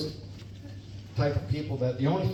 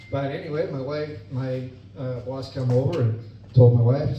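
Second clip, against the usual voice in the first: ordinary talk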